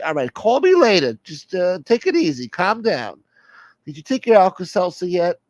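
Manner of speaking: high-pitched voice calming someone down; exasperated soother, placating. Acted. Plays the soother.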